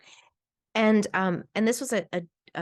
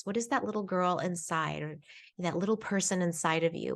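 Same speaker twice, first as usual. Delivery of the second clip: ordinary talk, nothing marked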